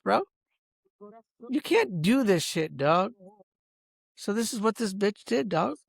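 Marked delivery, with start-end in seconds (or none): none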